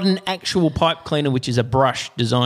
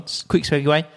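usual speaking voice

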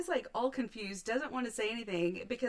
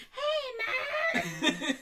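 mock kids calling.

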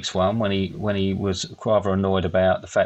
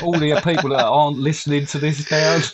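hammed-up lament, pitched up, laughter creeping in; mock despair, higher and laughing; mock-contrite apology; 5 semitones higher than usual. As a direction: overplay the apology until you crack up. Mock grovelling apology to listeners.

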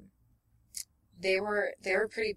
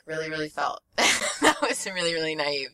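ordinary talk

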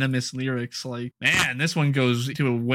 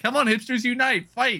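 much higher, hammed-up call to action; rally them, sarcastically; mock rallying cry; 11 semitones higher than usual. Podcaster imitating singer rousing hipsters, mock call to action. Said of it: "like, a call to action".

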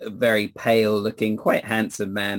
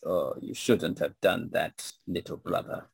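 vampire lord voice; 3 semitones lower, 7 dB quieter than usual. Acted. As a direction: purr a quiet vampire threat.

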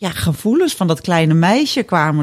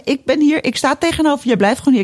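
higher-pitched, insistent imitation of a coach; 8 semitones higher than usual. Coach refusing to let her leave, insistent. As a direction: stand your ground as the retold coach. Acting out the coach standing firm.

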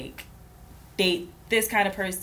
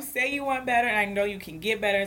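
sassy tough love; 5 semitones higher, faster than usual. Retold or imitated. Tells a friend she wants better for her, sassy and higher. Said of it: "And I'm just like".

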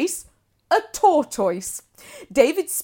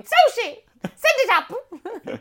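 demanding diva; 9 semitones higher, much slower than usual. Pretend. [name] calling at 2 a.m.

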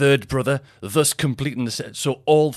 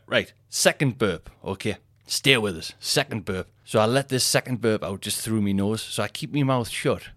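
ordinary delivery

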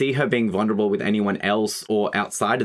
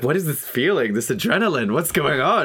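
giddy excitement; 7 semitones higher than usual. Retold or imitated. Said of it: "she's like".

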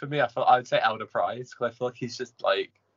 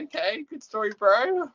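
sarcastic. Retold or imitated. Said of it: "I was like".

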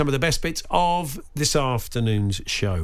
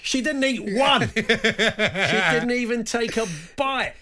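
mock indignant shopkeeper; 7 semitones higher, slower than usual. Indignant shop owner after door slam.

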